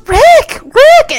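high eager calling of his name; excited calling. Retold or imitated. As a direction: call Rick, thrilled and breathless. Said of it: "She, like, Ri-, goes".

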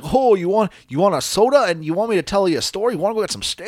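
put-on high, friendly Cleveland dad accent; mock Cleveland accent, high and kindly. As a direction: play a sweet Cleveland dad offering soda. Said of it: "That's a very nice Cleveland accent you have there".

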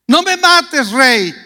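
high pleading; mock plea. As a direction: beg the king for mercy, high and mock-scared. Simei begging for his life.

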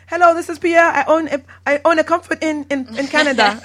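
loud, high mock French; hammed-up French businessman on the phone; bad French accent. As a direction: do a terrible French accent, loudly. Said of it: "I always do a bad French accent".

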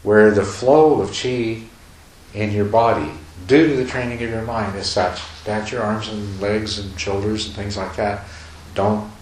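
ordinary talk, nothing marked

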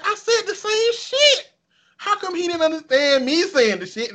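very high mock-indignant wail; exasperated protest. Retelling teammate's misunderstanding, high-pitched exasperation. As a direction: stretch the complaint out in falsetto. Retold protest at not being understood.

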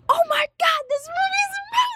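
very high, squealed movie-night excitement; excited gushing. Retold or imitated. Friend re-enacting their reaction to the movie, excited gushing. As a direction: gush in a sky-high voice.

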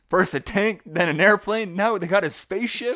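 hammed-up incredulous comic delivery; Roman. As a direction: build the list like a comic complaint. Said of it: "Roman's best line in the movie is like".

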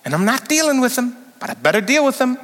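hammed-up grudging reluctance; mock reluctant self-talk. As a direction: whine it like you don't want to. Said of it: "You go".

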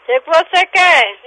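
high, insistent demand; fed up with a nuisance caller; real exasperation. Real, not acted. Demands what the caller wants.

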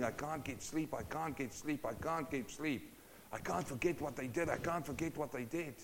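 soft, weary repeated complaint; tired murmur in the dark; restless lament. Acted. Sleepless man's restless lament.

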